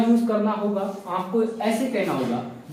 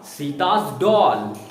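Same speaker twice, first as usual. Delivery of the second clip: slow teaching demo; 7 semitones lower, much slower than usual. Shown as a demonstration. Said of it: "मैंने कहा सीता's doll".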